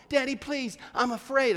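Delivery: high-pitched mock begging; imitated frightened pleading. Frightened daughter begging her father in car wash, imitated pleading. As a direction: imitate a scared child's plea. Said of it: "She started wailing, screaming, crying".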